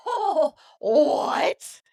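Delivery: high, loud, drawn-out astonishment; shocked disbelief. Retold or imitated. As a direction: replay being floored by what you heard. Acting out her shocked reaction.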